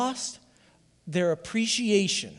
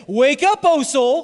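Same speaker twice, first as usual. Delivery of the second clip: loud, high, drawn-out rallying cry; rousing call; 7 semitones higher, 9 dB louder than usual. Shown as a demonstration. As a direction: lead the congregation in a shouted refrain. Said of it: "say it with me again".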